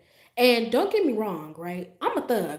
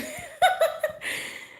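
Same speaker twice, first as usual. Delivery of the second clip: very high squeal of laughter. Real, not acted. Recalling his look, squealing with laughter.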